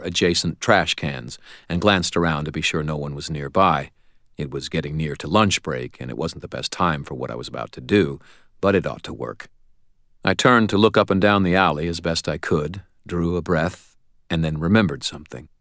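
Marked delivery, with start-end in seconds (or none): none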